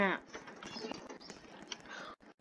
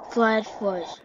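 usual speaking voice

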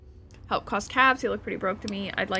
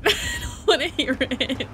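laughing; 3 semitones higher than usual. Real, not acted.

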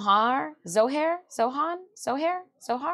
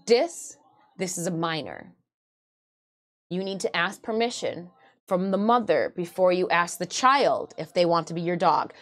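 low, lowered voice of conscience; inner filter; 7 semitones lower than usual. Acted. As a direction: caution yourself like an inner censor. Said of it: "my filter goes".

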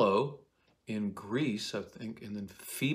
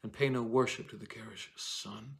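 dramatic reading; 4 semitones higher than usual. Acted. Actor reading Juliet's lines with longing. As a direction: recite Shakespeare with feeling, a little higher. Reads Juliet's speech aloud.

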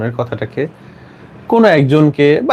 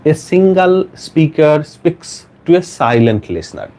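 very slow, word-by-word reading; slow dictation; 3 semitones higher, much slower than usual. Shown as a demonstration. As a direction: spell out each phrase slowly for note-takers. Teacher dictating answer word by word. (ধীরে ধীরে একেকটি শব্দ পড়া)

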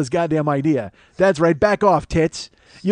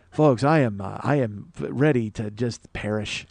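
low, slow, weary confession; downcast; 9 semitones lower, 4 dB quieter, slower than usual. Real, not acted. Broke host confessing to his listeners, downcast. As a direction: sink into a quiet, halting admission.

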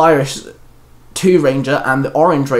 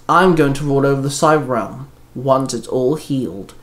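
plain reading voice

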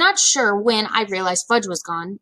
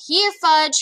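higher, louder sing-song call; calling a dog; 7 semitones higher, 3 dB louder than usual. A narrator's reading. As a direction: call him like a lost puppy.

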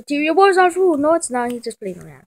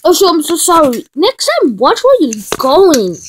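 mock angry scold; 4 semitones higher, 11 dB louder than usual. Bumped into someone, scolding.

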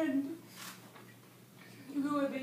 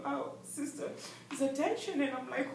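ordinary delivery

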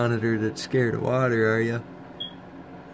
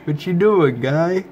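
loud teasing pet talk; 5 semitones higher, 8 dB louder, slower than usual. Real, not acted.

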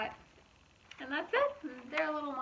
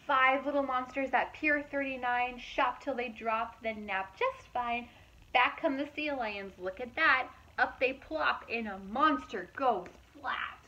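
lively, louder storybook narration; reading to children; 2 semitones higher, 7 dB louder than usual. A narrator's reading. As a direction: read it out brightly to a young audience. Reading picture book aloud.

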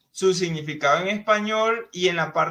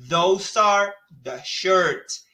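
slow model sentence; 3 dB louder, much slower than usual. Shown as a demonstration. Teacher modelling English for Spanish-speaking students, slow and careful. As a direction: spell out the English sentence slowly, word by word. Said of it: "Fíjense cómo aquí decimos".

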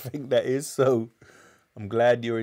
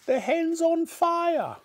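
piercing high, stretched alarm cry; yelling; slower than usual. A narrator's reading. Grandma spotting smoke from the hen, yelling. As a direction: screech the alarm slowly in a granny voice. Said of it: "Grandma yelled".

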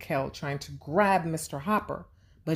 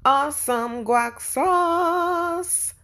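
slow, loud, stretched-out cheer; cheering catchphrase; 12 semitones higher, 5 dB louder, much slower than usual. Acted. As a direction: celebrate the right answer with a big drawn-out cheer. Host cheers right answer.

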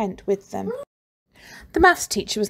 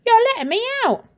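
put-on fast, much higher happy boy; high boy's voice; 14 semitones higher, 5 dB louder, much faster than usual. Boy thanking the teacher, fast high delighted voice. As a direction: blurt it out gratefully.

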